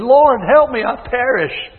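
high, loud cry of a drowning man; desperate cry for help. Retold or imitated. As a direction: call out to the Lord as you sink. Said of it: "He cried out".

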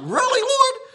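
high, disbelieving squeak at the Lord; incredulous. Retold or imitated. Preacher balking at the Lord's answer, high and incredulous. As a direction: pitch it way up in disbelief.

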